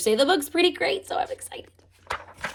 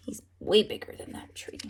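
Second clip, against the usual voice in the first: whisper; 3 semitones lower, 6 dB quieter, faster than usual. Real, not acted. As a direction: breathe it as a secret. Aside whisper to kids.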